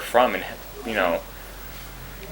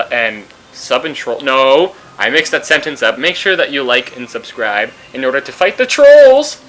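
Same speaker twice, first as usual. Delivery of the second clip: usual speaking voice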